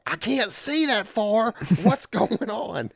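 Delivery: put-on squeaky kid who can't see a thing; panicky kid voice. Kid retold squinting at target.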